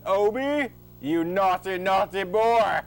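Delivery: high, loud mock scolding; hammy scolding. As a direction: scold the naughty boy theatrically. Father scolding his son.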